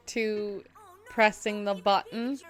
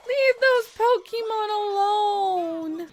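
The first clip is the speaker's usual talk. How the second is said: very high, slow, loud wail; protesting wail; 13 semitones higher, 5 dB louder, slower than usual. Real, not acted. Viewer pleading with villains to leave Pokemon alone, high wail. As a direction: stretch the plea out high and indignant.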